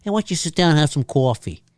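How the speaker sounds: lightly mocked New York accent; mock New Yorker accent. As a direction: tease with a touch of New York. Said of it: "Are they from New York?".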